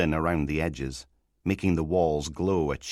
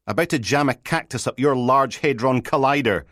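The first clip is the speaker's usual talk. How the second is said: higher, louder, grumpy retort; irritated threat; 7 semitones higher, 5 dB louder than usual. Acted. Woken sergeant to the constable who rang, irritated threat. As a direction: snap back at an unwelcome caller.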